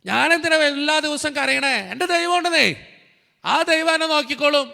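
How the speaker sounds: high, loud, ringing preacher's cry; rising revival sermon, voice lifted high and loud; fiery preaching. Acted. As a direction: preach it out to the whole hall at full force. Full-force preaching climax.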